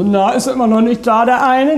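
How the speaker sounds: lauter, höher neckend nachgeäfft; spöttisch nachfragen wie die Bekannten; neckende Frage. Retold or imitated. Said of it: "immer so". (spöttisch nachgeahmte Bekannte)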